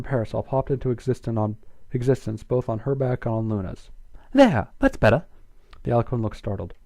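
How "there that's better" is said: loud, high cheerful shout; triumphant. Acted. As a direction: cheer it out proudly. Twilight triumphant.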